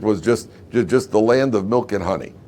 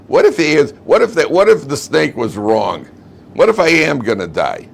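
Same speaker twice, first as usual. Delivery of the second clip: raised, louder mock panic; acted sudden worry; 6 semitones higher, 6 dB louder than usual. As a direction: fret aloud with rising alarm, hammed-up. Acts Eve's sudden worry about dying.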